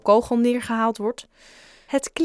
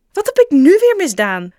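very loud, high exasperated protest; protesting shout; 9 semitones higher, 12 dB louder than usual. Acted. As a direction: yell your innocence. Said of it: "protesteerde Joe".

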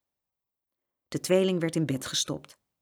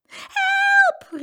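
loud, much higher cry for help; 7 dB louder than usual. Acted. Saskia falls in the mud, shrill loud cry. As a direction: shriek as she falls. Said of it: "riep Saskia".

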